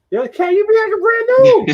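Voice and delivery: high and loud, a hammed-up teasing call-out; mock jab. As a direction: razz your friend loudly in a put-on voice. Playful jab repeated at friend.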